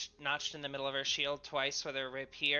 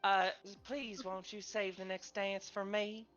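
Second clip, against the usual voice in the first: higher Southern belle invitation to dance; southern accent; 5 semitones higher than usual. Acted. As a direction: flirt sweetly in a Southern accent. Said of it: "she would say it in a southern accent".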